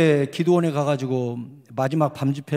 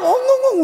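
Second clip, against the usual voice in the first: imitated sobbing; 5 dB louder than usual. Pastor imitating the accompanist crying, high mock sobs. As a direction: wail like a crying student. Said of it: "우는 거예요".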